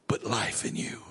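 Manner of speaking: sudden hushed whisper after the shout; preacher hushing the crowd. Real, not acted. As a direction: drop to a whisper.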